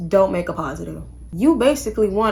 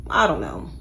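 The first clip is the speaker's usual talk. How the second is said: sarcastic aside; 12 semitones lower than usual. Real, not acted. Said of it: "sarcastic low aside".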